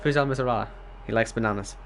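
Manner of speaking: ordinary delivery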